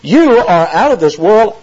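"You" drawn out high; emphatic YOU. Acted. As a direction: stress "You" hard and take it slowly. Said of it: "another emphatic pronoun".

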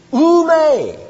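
Oume sung out high and loud; Greek word. Shown as a demonstration. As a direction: call out Oume in a high, ringing voice. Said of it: "perfectly good Greek".

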